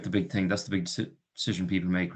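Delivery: normal talking voice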